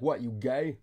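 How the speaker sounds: put-on weak Jersey accent as Carl. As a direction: do Carl's Jersey accent, half-hearted. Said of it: "I can't do a Jersey accent anymore too well".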